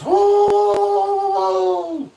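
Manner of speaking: sudden high loud yelp imitating the dog; imitated dog yelp. Telling viewers how the new dog suddenly goes off, loud high yelp. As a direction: mimic the dog's sudden bark-like yelp. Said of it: "he just random goes".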